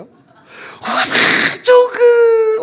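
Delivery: 오베 좋은 그 very slow and high, crying out; Jeolla exclamation. Retold or imitated. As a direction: stretch 오베 좋은 그 with heartfelt delight. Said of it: "그 고백이 나오십니까".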